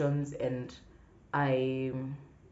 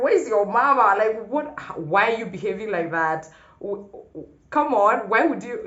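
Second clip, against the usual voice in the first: mock scolding auntie, higher and louder; older lady scolding; 7 semitones higher, 9 dB louder than usual. Older lady scolding girl in the street, mock scolding. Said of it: "I just like".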